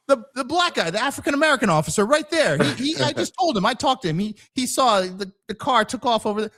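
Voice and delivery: high, hurried bystander explanation; insistent witness. Acted. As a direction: imitate a flustered witness insisting on who was told. Voices a witness pointing out officer.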